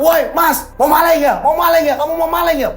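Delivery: high-pitched accusing yell; sudden shout. Acted. Security guard calling out a suspected thief, sudden accusing shout. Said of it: "tiba-tiba teriak".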